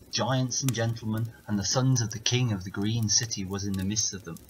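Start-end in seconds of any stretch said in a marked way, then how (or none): none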